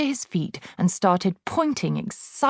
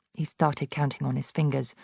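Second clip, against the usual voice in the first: usual speaking voice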